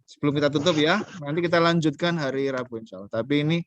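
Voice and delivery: ordinary talk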